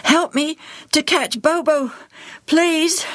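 put-on high boy's voice, out of breath; panting. A narrator's reading. Joel chasing his lost parrot asks for help, panting. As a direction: plead while panting, pitched high. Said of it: "Joel panted".

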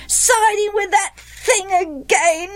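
put-on slow, high childish echo; chorusing boys. A narrator's reading. Boys echo mother's complaint to father, chorusing. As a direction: parrot the complaint in a high pitch. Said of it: "the other boys chorused".

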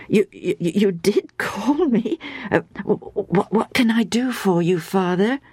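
put-on stammering, eager child; hesitant young boy's question; flustered boy. A narrator's reading. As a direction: trip over the first words, softly bewildered. Boy runs in, stammering to priest.

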